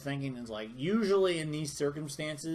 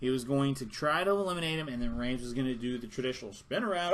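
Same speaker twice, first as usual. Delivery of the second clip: usual speaking voice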